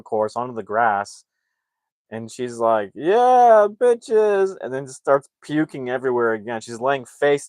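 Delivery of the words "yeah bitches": loud, high party cheer; mock triumphant whoop. Narrator mimics the mom landing on grass, triumphant whoop.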